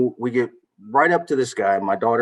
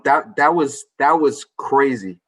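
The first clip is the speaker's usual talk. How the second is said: ordinary talk, nothing marked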